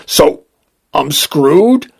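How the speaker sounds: a touch of crying, understated; mildly tearful. A narrator's reading. Client learns his body may seize up, mildly tearful. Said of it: "I started to cry".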